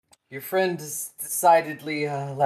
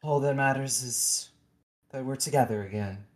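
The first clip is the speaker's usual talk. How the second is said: soft, hushed words to his daughter; tender father; 6 dB quieter, faster than usual. Acted. Father gently comforting his daughter, soft and hushed. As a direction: cradle her with your voice. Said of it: "he just goes".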